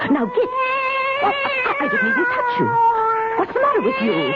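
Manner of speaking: high, startled cries at an intruding cat; alarmed shooing. Acted. Old woman startled by a strange cat in her house, high alarmed cries. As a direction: shoo it out, voice climbing in panic.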